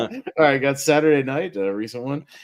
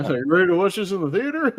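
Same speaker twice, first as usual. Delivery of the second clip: Tigger voice; 6 semitones higher than usual. Retold or imitated. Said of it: "Like doing the, like, Tigger voice from Winnie the Pooh".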